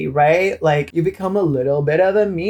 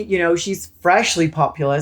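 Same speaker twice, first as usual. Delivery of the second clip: normal talking voice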